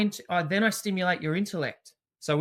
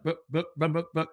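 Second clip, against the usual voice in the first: belly drum sounds; faster than usual. Retold or imitated. Teacher recalling the bear's belly pat for a child, rhythmic buh buh. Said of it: "what sound did he make".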